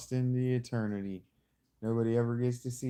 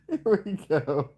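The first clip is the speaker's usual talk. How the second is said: laughing; 10 semitones higher, 9 dB louder than usual. Real, not acted. Still laughing, high.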